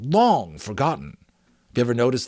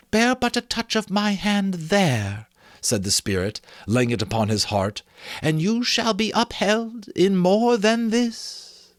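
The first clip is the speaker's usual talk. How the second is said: reassuring ghost; 9 semitones higher than usual. A narrator's reading. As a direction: soothe him like a kind spirit.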